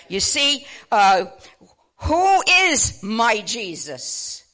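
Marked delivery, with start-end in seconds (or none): none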